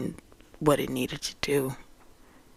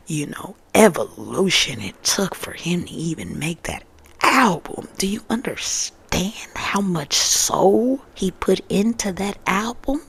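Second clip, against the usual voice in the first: passionate outburst; 4 semitones higher, 6 dB louder than usual. Real, not acted. Passionate rant about critics.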